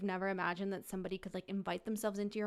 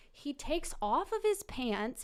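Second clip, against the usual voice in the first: usual speaking voice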